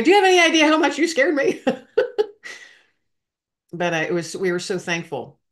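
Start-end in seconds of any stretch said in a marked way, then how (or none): 0.0-1.6 much higher and louder, laughing through it, fast; a relieved half-laughing scolding; to the sergeant who scared her, a laughing scold; laughing mock scold. 1.7-2.5 relieved laugh. Real, not acted. Laughs after relieved scolding.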